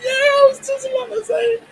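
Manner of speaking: high pitched and laughing through it. Real, not acted. Agreeing with his co-host about the ruined dish, laughing. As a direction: giggle out the words.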